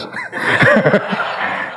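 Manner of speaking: amused, breathy chuckle. Real, not acted. Preacher amused by Suraqah's arrow draw, chuckling. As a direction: let out a short laugh.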